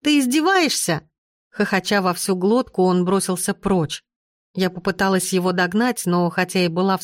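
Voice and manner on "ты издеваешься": high-pitched, affronted question; indignant protest. A narrator's reading. Heroine to the friend mocking her face, indignant protest.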